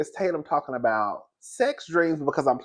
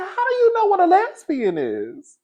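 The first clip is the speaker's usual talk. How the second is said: incredulous. Retold or imitated. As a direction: challenge her in disbelief. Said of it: "I said".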